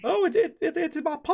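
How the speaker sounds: very high squeaky goblin voice; excited little goblin squeak. Acted. Goblin Prince voice.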